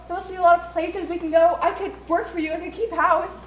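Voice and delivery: high pleading. Acted. Bride pleading with father.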